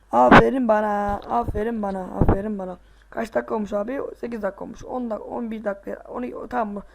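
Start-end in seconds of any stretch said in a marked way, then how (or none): 0.1-2.8 mock self-praise. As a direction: crow it out over your own little win. Self-praise after a jump.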